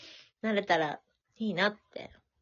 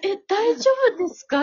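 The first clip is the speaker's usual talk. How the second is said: worried sister-in-law; 13 semitones higher, 7 dB louder than usual. Retold or imitated. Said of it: "って言われる".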